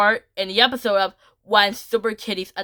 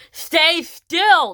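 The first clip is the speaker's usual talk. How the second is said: high, loud acted order; commanding villain; 8 semitones higher, 5 dB louder than usual. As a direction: order them sharply, pitched up. Scout orders her prey.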